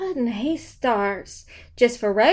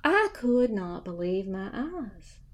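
usual speaking voice

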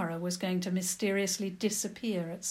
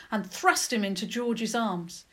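usual speaking voice

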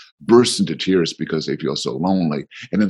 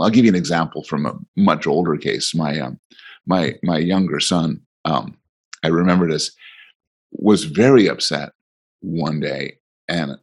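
ordinary talk, nothing marked

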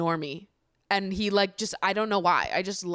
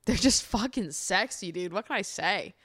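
ordinary talk

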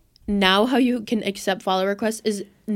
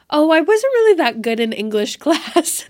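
laughing brush-off; 6 semitones higher, 5 dB louder than usual. Retold or imitated. Retelling her awkward brush-off reply.

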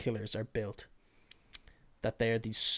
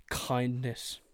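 ordinary delivery

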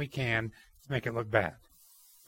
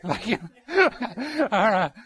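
laughing; 7 semitones higher, 7 dB louder, much slower than usual. Real, not acted. Laughing at the thought.

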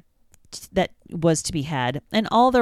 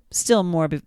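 plain reading voice

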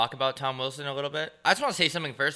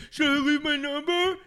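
high, put-on eager bro voice; mock dude-bro; 13 semitones higher, 4 dB louder than usual. Comic imitating guys who flirt with the waitress, mock eager. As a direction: imitate the clueless bro, pitched way up. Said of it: "same guys that are like".

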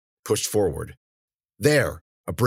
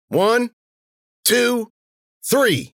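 high, raised counting voice; called-out count; 4 dB louder, much slower than usual. Acted. Warner counting for his partner to throw, called out. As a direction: count it off with effort before heaving.

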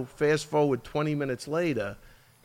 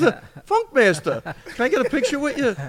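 put-on high, halting, eager kid voice asking for a photo; starstruck young fan; 11 semitones higher, 5 dB louder than usual. A tearful young fighter spots his idol and asks for a picture, high starstruck voice. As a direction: pitch it way up, stammering like a starstruck kid.

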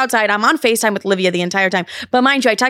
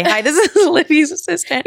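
higher voice broken by a laugh; laughing retold quote; 6 semitones higher than usual. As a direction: giggle through the introduction. Retold line breaking into laughter.

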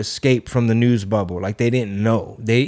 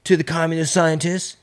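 raspy, much higher impression voice; smoker's rasp; 6 semitones higher than usual. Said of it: "I sound like I've been smoking cigarettes all day".

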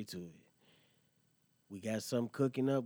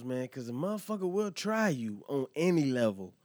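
pushed up and louder, driving the point home; emphatic; 4 semitones higher, 6 dB louder than usual. Real, not acted. Host warns listeners about being tested, emphatic. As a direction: lean hard into the warning.

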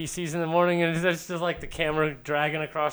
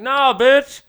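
loud, high, dismissive nah bitch; mock sass; 9 semitones higher, 12 dB louder than usual. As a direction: brush him off in front of friends. Imitating girl showing off.

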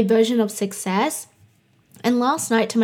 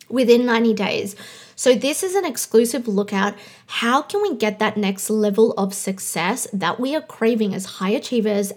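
normal talking voice

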